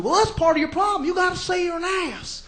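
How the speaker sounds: mocking, pushy advice guru; Doctor Phil. TV host pressing his guest, mock insistence. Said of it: "he's like".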